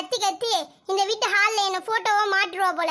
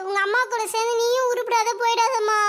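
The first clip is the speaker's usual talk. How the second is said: ordinary talk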